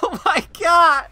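high, loud, drawn-out cry; despairing wail. Real, not acted. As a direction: wail it slowly in utter exasperation. Despair at broken ladder.

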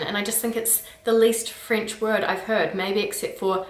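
ordinary delivery

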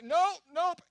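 high, loud flat-out nope; loud refusal. Retold or imitated. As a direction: shoot the idea down, loud and high. Refusing in disbelief.

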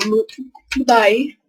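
usual speaking voice

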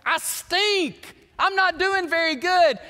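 loud, high-pitched pleading, acted out; mock despair, pushed high and loud; imitated tearful plea. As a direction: plead like a distraught rookie, hammed up. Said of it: "and he's weeping, he's crying".

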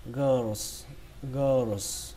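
put-on "girls" as "gơ-rồ-s", the "rồ" very short; "girls" with light "rồ". Shown as a demonstration. Teacher models the second way of reading, "girls" as "gơ-rồ-s" with a light "rồ". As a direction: brush quickly over a "rồ" inside "girls". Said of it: "Cách đọc thứ hai của từ này".

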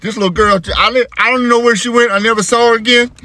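high-pitched, rattled aftermath voice; nervous. Acted. Dad after losing sight of the girl, nervous high voice. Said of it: "I was so nervous".

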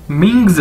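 "मिंग्स" as ming-z, falling at the end; model pronunciation. Shown as a demonstration. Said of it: "क्या बन जाएगा".

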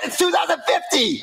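high-pitched, exasperated cry; outraged protest. Acted. Friend hears game is still delayed, high outraged protest.

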